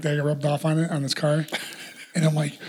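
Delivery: normal talking voice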